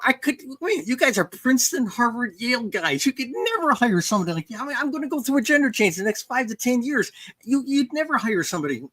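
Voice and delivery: retold disbelief, pitched well up throughout; mock astonishment at a ridiculous offer, high; incredulous reply. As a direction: protest in disbelief, voice lifted high. Retelling own incredulous reply.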